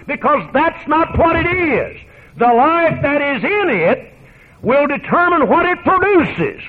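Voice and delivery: building sermon fervour; preaching at full force. Acted. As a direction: drive the point home from the pulpit.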